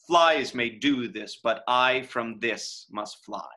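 slow verse, "Flies" stressed first, then iambic; trochaic opening. Shown as a demonstration. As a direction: land on "Flies", then keep a measured beat. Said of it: "The first word of the line is accented".

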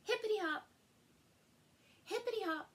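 high, sing-song hippity hop; bouncy rabbit hops. A narrator's reading. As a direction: bounce the words like a hopping rabbit. Rabbit hopping sound effect.

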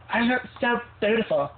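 put-on high girly self-admiring; high preening girl voice. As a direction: coo it in the girl voice. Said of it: "I'm like".

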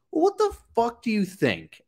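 normal talking voice

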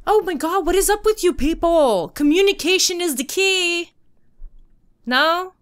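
loud, high, fed-up rant; exasperated outburst. Real, not acted. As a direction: cry out in exasperation.

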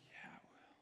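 hushed whisper; whispered reassurance. Retold or imitated. Father whispering a promise to his son. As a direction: breathe it softly to him.

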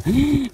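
a quick, high, startled gasp; sharp gasp. Real, not acted. Farmer startled by his cow's udder, sharp gasp. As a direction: catch your breath in shock at the cow's udder.

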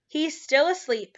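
ordinary delivery, nothing marked

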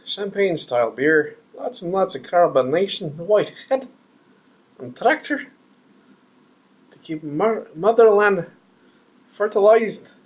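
high, singsong mock Russian accent. Beer reviewer describing his glass, hammy Russian accent. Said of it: "I don't know why I'm doing a Russian accent".

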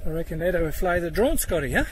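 ordinary talk

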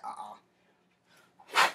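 loud low whispered uh-uh; a sharp grunt as you slip the punch; mock dodge grunt. Dodging Corey's uppercut, loud low whispered uh-uh.

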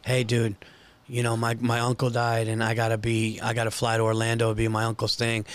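low, hushed sad message; somber. Retold or imitated. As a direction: speak it low and grieving. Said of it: "imagine saying something horrible happened".

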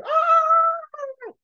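high, loud scream of terror, hammed-up; mock scream. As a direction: scream as the flood wave arrives. Wall of water coming.

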